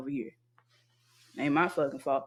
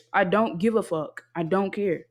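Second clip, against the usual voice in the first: ordinary delivery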